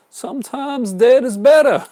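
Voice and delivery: high, loud, drawn-out hammed-up movie quote; bad movie-line imitation. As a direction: badly imitate a famous film line, slow and loud. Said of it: "Sorry, that's my really bad imitation".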